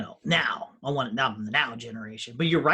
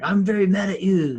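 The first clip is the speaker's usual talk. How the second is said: high, loud mocking parody of a protester; mocking a furious online protester, loud; mock angry ranting; 5 semitones higher, 9 dB louder than usual. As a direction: ham it up like an outraged ranter. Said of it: "are like".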